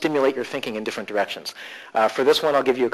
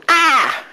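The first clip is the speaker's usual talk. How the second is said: short, loud, high-pitched shriek; mock panicked yelp; 13 semitones higher, 6 dB louder than usual. Student put on the spot, mock panicked yelp. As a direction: yelp as if caught off guard.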